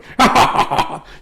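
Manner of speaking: loud, high cackle in character; mock laugh. German dad laughing at little boy, loud mock cackle. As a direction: cackle at the boy's misfortune.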